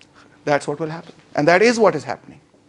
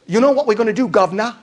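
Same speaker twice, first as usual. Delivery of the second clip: hammed-up British working-class accent, higher; terrible British worker accent; 3 semitones higher, faster than usual. Organized worker telling off the boss, bad British accent. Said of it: "sorry, terrible accent".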